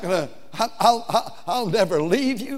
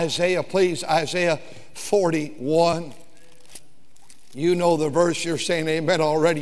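usual speaking voice

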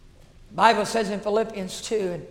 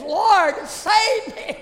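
high, loud, pleading cry; a broken plea for mercy; crying out to God; 11 semitones higher, 8 dB louder, much slower than usual. Retold or imitated. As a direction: voice cracking on a desperate prayer. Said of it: "begins to weep and cry".

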